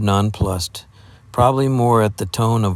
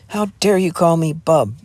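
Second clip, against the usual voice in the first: higher, heated indignant cry; hot outrage; 8 semitones higher than usual. Acted. Jeannie furious at being called Bub, hot cry. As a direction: bristle at the insult. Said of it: "cried Jeannie hotly".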